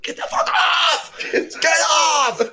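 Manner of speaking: shouting at dogs. Retold or imitated. Yelling at the dogs on the bed, shouting. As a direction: yell them off the bed.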